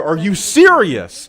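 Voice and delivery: slow, loud, drawn-out disbelief; slow incredulous question; stunned disbelief. Real, not acted. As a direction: stretch it out, floored. Reacting live to press conference.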